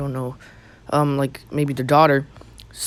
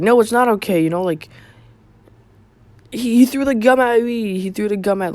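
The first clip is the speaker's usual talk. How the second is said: high, raised imitation of an outraged woman; mock shouting; 7 semitones higher, 4 dB louder than usual. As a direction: protest in a high, insistent voice. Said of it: "she starts shouting and screaming".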